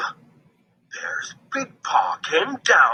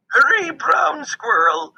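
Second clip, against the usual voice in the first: high, loud call to hurry; 14 semitones higher, 4 dB louder than usual. A narrator's reading. Grandmother calling grandson from the doorway, loud and high. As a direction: call him home urgently. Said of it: "she called".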